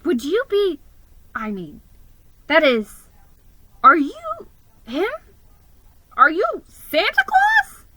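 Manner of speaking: high, halting astonished question; surprised. Acted. Mouse asking the man if he is Santa, astonished. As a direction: stumble over your words in awe. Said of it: "I asked, surprised".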